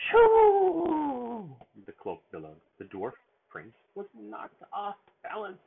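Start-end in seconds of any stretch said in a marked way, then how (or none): 0.1-1.5 huge high, loud sneeze burst; big sneeze. Acted. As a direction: let the sneeze explode.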